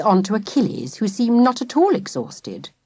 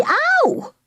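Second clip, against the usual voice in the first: higher yelp of pain; sharp pained yelp. A narrator's reading. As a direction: cry out as the tail hits. Tail lashes father.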